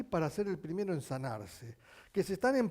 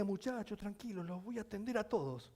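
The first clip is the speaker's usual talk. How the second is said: loving reassurance; 4 semitones higher, 9 dB quieter than usual. Retold or imitated. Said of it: "respondió siempre con amor".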